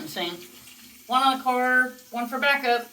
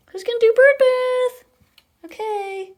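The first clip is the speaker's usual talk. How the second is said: high loud coo; 12 semitones higher, 7 dB louder than usual. Real, not acted. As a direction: belt the question up high to the bird. Cooing to her parrot.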